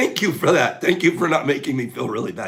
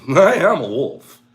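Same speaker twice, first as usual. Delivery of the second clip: I am a wolf, louder and higher with a laugh; 3 semitones higher, 9 dB louder, slower than usual. Acted. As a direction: shout I am a wolf playfully.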